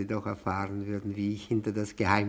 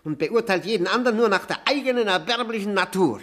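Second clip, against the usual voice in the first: loud, fast, higher tirade with a sneering laugh; bitter outburst; 5 semitones higher, 5 dB louder, faster than usual. Acted. Guest accusing the host's kind, bitter loud outburst. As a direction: lash out at him, laughing bitterly.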